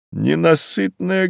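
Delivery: high-pitched grieving reproach; anguished outcry. Acted. Widower reproaching the earth for his wife, in anguish. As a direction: cry out against the devouring earth.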